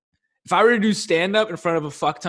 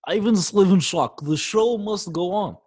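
slow, higher mock Russian accent; 5 semitones higher than usual. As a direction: announce it like a Russian theatre owner, slowly. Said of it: "in a Russian accent".

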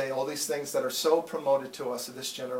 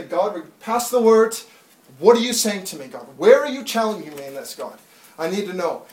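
earnest prayer; 2 semitones higher, 9 dB louder than usual. Acted. Voiced prayer of the believer.

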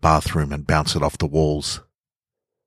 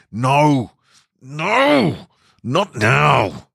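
frantic yells of no; panicked cries; 9 semitones higher, much slower than usual. Acted. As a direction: cry out in rising panic. Narrator panics as she glows.

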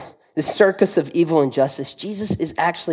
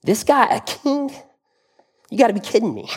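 scornful disbelief; 6 semitones higher than usual. Retold or imitated. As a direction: scoff at the idea like Pilate would. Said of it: "such a ridiculous question".

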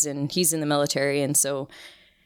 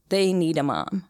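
ordinary delivery

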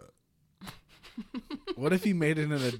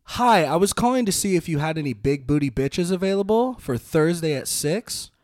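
higher, louder caller voice; phone caller voice; 5 semitones higher, 10 dB louder than usual. Pretend. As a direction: play an eager caller on the phone. Prank caller role in improv.

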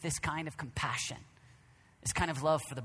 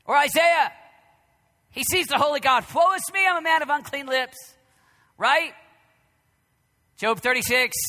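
loud, high preaching shout; fiery sermon shout; 10 semitones higher, 11 dB louder than usual. Acted. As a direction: thunder it from the pulpit. Preaching at full force.

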